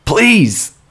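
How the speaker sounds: high loud begging cry; desperate cry. Acted. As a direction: cry out for mercy.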